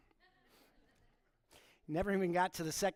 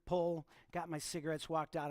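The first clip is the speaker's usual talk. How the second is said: usual speaking voice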